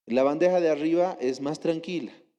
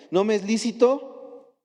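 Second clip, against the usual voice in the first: ordinary delivery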